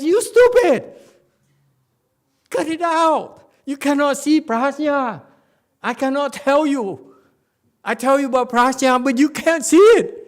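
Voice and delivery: very high, full-force acted yelling; furious scolding shout. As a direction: bawl them out as an exasperated master. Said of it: "He says, he says".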